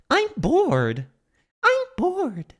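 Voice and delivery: very high mimicry with North American "bored"; imitated North American accent. Streamer copying the character's voice line, high mock North American accent. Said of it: "there's a perfect example".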